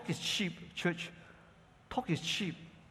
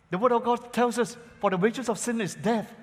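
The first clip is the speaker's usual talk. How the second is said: forceful preaching; 6 semitones higher than usual. Acted. Pastor proclaiming scripture to the church, forceful.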